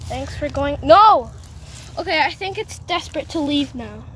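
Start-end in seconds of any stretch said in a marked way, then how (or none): 0.8-1.3 quick alarmed shout; sudden loud no. Real, not acted. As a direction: blurt it out loud. Lizard about to escape.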